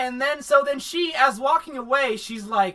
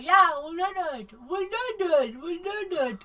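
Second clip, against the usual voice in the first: high, eager, fading yes-men; squeaky hurried agreement; high mock eager voice trailing off; 9 semitones higher than usual. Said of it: "they're like".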